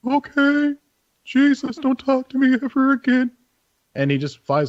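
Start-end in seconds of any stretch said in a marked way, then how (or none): 0.1-3.3 high, huffy owl voice; a put-upon owl telling him off; annoyed owl. Acted. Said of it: "you hear the owl go".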